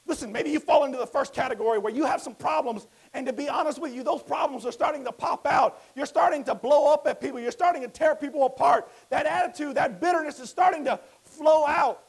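loud, high, driving preacher voice; full-force preaching. Acted. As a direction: preach it hard to the congregation.

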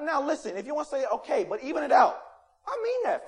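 ordinary talk

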